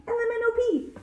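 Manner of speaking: high, loud run of letters; excited announcement. A narrator's reading. Letters crowd up the tree.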